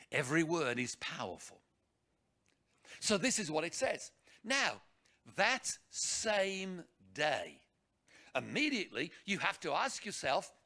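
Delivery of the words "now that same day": very slow, deliberate scripture reading. Shown as a demonstration. Preacher showing how to read the Bible, very slowly. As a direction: read it slowly, weighing every word. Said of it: "Read it slowly".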